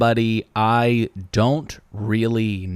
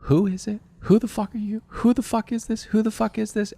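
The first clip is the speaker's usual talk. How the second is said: panicked inner voice; 11 semitones higher, 4 dB quieter than usual. Retold or imitated. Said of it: "And in my mind".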